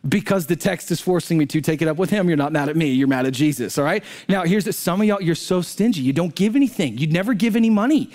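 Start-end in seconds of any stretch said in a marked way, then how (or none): none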